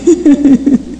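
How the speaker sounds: short amused chuckle; laugh. Real, not acted. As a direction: laugh briefly at your own story. Laughs at her own story.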